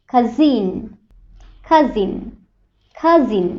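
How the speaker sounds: কাজিন with a voiced z in the middle; z sound in cousin. Shown as a demonstration. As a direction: buzz the z in কাজিন. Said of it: "এরকম sound হবে".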